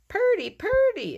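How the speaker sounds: very high, loud whistle-like chirp; bird call. A narrator's reading. Mole's whistle calls a red bird, high chirping. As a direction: pipe it up like a songbird. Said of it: "it called".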